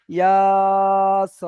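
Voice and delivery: Yeah স with both syllables held long; two equal elongations. Shown as a demonstration. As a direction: stretch yeah and স evenly. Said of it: "একই টান হবে".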